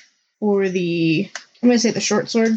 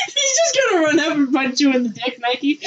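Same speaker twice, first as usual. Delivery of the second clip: laughing; 5 semitones higher than usual. Real, not acted. Laughing while narrating.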